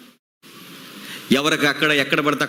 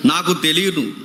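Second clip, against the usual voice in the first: dramatic scripture reading; 6 semitones higher than usual. Acted. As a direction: declaim the warning like a prophet. Reads scripture over music.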